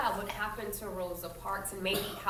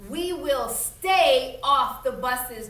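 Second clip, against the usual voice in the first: slow, louder preacher cadence; intoned; 5 semitones higher, 8 dB louder, much slower than usual. Acted. Doctor King addressing the mass meeting, intoning slowly like a preacher. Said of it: "Doctor King intoned".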